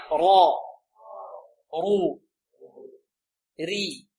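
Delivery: را رو ري, heavy را and رو, light ري; ra vowel drill. Shown as a demonstration. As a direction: chant را رو ري for the class. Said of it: "انطقوا معي". (نطق را رو ري بالتفخيم والترقيق)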